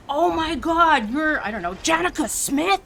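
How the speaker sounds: a hint of a star-struck worker; amazed worker. Worker recognizing homeowner's name, mildly amazed.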